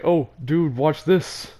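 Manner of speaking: acted-out excited heads-up; excited showing off. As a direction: call out like you're about to show off. Re-enacts showing off to friend.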